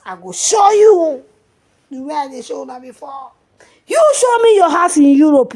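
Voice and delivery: high, loud challenging yell; defiant shout. Real, not acted. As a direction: throw down the challenge loudly. Boastful challenge to the woman.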